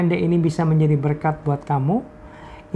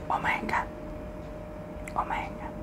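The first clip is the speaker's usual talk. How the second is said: much softer, whispered awe; awed whisper; 15 dB quieter than usual. Real, not acted. Pastor marvels at the answered prayer, whispering. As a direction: breathe it out in amazement.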